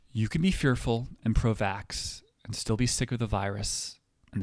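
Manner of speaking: deliberate announcer read with weighty pauses; mock public service announcement. Comedian delivering a satirical public service announcement, declamatory. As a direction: read it out like a formal statement.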